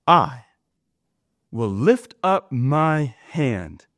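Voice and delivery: slow, weighty reading of the verse; solemn scripture reading. Acted. Preacher reading Isaiah to the congregation, solemn and slow. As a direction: lay each phrase down with gravity.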